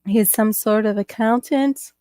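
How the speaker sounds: ordinary delivery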